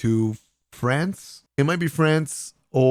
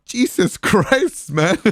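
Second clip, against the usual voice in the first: slow words broken by high laughter; laughing; 12 semitones higher, 3 dB louder, much slower than usual. Real, not acted. Vlogger cracking up retelling Piercho's cake grab, laughing.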